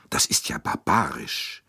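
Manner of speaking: a touch of low, hushed horror; horrified. A narrator's reading. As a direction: recoil at the brutality. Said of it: "riss entsetzt die Augen auf".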